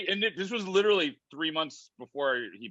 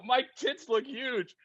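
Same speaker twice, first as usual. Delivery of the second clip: slow, higher, drawn-out lament; mock dismay; 10 semitones higher, slower than usual. Looking at his photo with [name], slow higher mock lament. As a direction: stretch it out in mock horror. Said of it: "I go".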